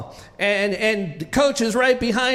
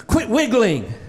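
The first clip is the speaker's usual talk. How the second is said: higher-pitched imitation of a yelling coach; mock yell; 3 semitones higher than usual. Coach to a wiggling young swimmer, mock yell. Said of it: "he would yell at me".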